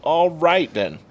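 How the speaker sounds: high, loud mock-stunned reaction; taken aback. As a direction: act out being floored by an insult. Stunned reply to the insult.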